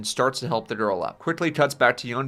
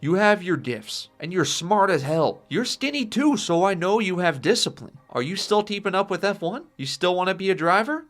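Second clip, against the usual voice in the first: raised, insistent fatherly appeal; urgent pleading; 7 semitones higher, 4 dB louder than usual. Acted. Imprisoned Henry urging son to live his life, pleading. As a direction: press him earnestly, pitch lifted.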